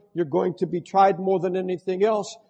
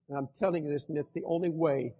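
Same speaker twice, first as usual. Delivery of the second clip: usual speaking voice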